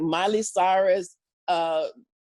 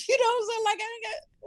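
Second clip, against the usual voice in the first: high, laughing through words; 14 semitones higher than usual. Real, not acted. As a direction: crack up mid-sentence. Laughing while retelling disbelief.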